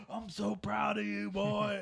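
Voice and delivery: hammed-up fatherly pride; proud dad. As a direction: push the praise out big, like a beaming dad. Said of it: "my dad's like".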